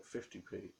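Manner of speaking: ordinary talk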